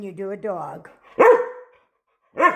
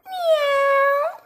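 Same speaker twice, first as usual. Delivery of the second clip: mock meow. Shown as a demonstration. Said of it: "Can you do a kitty cat?".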